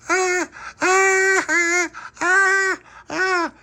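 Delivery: high squeals rolling down a hill; tumbling squeals that could be crying; squealing while tumbling. Acted. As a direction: let out bouncy high squeals. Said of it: "Is she crying?".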